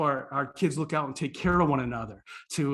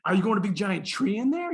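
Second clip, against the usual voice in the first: higher-pitched, wide-eyed wonder; excited; 5 semitones higher than usual. Retold or imitated. Visitor asking Chip about his plant, playfully excited. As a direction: play up amazement for a small child. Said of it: "being the excited person I am".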